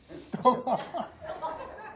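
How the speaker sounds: bursts of high laughter at the memory. Real, not acted. Delighted laughter at being asked if he's from Texas.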